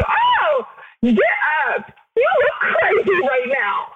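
high-pitched mock telling-off; exasperated self-scolding. Acting out talking to self in bathroom mirror, exasperated. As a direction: scold your own reflection. Scolding own reflection while crying.

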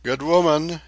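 narrator's lightly disguised voice; faltering, disguised voice. A narrator's reading. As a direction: pitch it up as a nervous disguise. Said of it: "he said in faltering tones".

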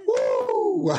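very high, loud whoop, then laughter; delighted whoop. Real, not acted. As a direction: cheer like the crowd just roared. Guest cheers at his introduction.

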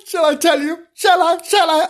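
high, coy teasing tone; high coy storyteller tease. Pretend. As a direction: dangle the secret in a high sing-song.

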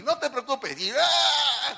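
a high, bright reassurance, then a sharp yelp; mock nurse and yelp. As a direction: play the nurse, then the patient's cry. Nurse cleaning wound, patient cries. (enfermera imitada y un grito de dolor)

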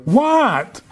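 hammed-up baffled question; baffled husbands. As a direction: play the clueless men, voice jumping up. Baffled men answering their wives' complaint.

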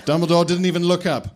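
plain reading voice

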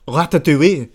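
Ratatouille in exaggerated French pronunciation; hammed-up French "Ratatouille"; mock French accent. Mock correction for a French man.

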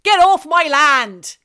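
loud West Country farmer, drawn out. Acted. Farmer ordering trespassers off, loud West Country. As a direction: holler it in a broad West Country burr. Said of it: "give us some West Country".

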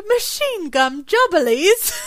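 slow, high, laughing through the words; laughing quote. Retold or imitated. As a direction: giggle out the line, dragging it. Quoting Austin, laughing.

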